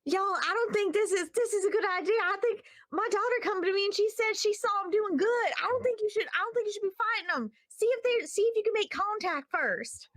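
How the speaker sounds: hammed-up Southern townsperson pleading over the radio; country Southern accent. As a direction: lay on the most country Southern accent possible. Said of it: "the most country Southern accent I can think of".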